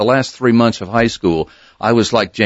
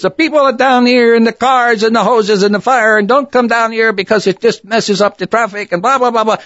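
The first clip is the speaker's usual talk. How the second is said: high, louder Jose Jimenez-style reporter; Jose Jimenez accent; 14 semitones higher, 4 dB louder than usual. Retold or imitated. Hank reporting the fire by phone, in Jose Jimenez accent. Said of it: "comedian Jose Jimenez".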